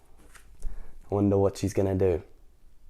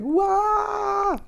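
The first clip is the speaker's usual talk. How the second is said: surprised wail. Acted. Shrunken arms reaction shout.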